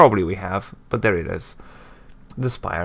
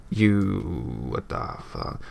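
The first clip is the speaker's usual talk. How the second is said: breathless whispered shock; whispered disbelief; 3 semitones lower, 8 dB quieter, much slower than usual. Real, not acted. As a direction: whisper it, stunned. Stunned aside.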